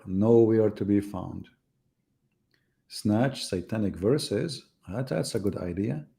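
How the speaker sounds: ordinary talk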